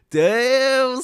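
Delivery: loud, high, drawn-out hype; impressed exclamation. Real, not acted. Streamer hyping the sponsor's discount, loud and impressed. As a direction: belt it out long and high.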